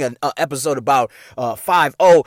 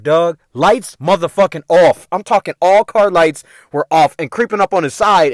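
normal talking voice

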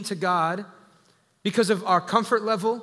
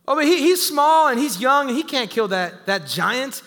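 higher, louder mock objection; protesting doubter; 4 semitones higher, 5 dB louder than usual. Voices a doubter objecting about David.

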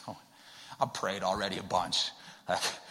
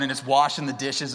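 ordinary talk

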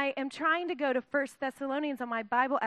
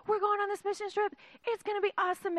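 eager Paul; 8 semitones higher, faster than usual. Retold or imitated. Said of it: "and Paul's like".

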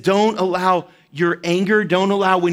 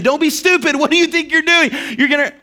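retold dad's scolding, pitched well up; angry parent yelling; 8 semitones higher than usual. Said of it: "I'm yelling at them".

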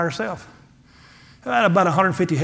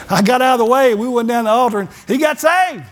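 louder, higher, triumphant telling; excited climax; 6 semitones higher, 5 dB louder than usual. Real, not acted. Preacher tells the congregation his friend got saved. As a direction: build to the joyful payoff.

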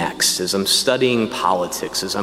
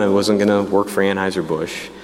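ordinary talk, nothing marked

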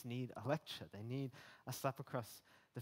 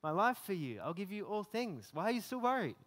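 higher, pressing, rising frustration; exasperated Jesus; 4 semitones higher, 4 dB louder than usual. Retold or imitated. Jesus reminding a worried believer, higher pressing plea. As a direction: insist like a loving teacher out of patience. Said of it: "imagining him speaking to me about that".

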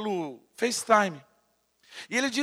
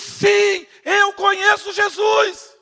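emphatic answer; 9 semitones higher, 8 dB louder, much slower than usual. Retold or imitated. Injured man answers.

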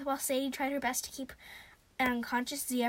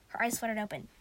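usual speaking voice

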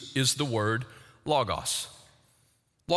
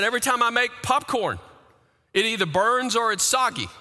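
higher, faster mock frustration; exasperated complaint; 9 semitones higher, 4 dB louder, faster than usual. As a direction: whine it fast and high like you've had enough. Repeated complaint, acted exasperated.

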